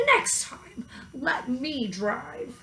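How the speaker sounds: weary cow muttering the last word; sighing cow. A narrator's reading. Mabel the cow to fainted Molly, trailing into a whisper. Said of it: "Mabel sighed".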